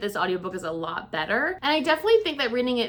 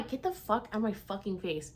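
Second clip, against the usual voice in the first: quiet aside; 7 dB quieter than usual. Real, not acted. Aside to brother mid-sentence.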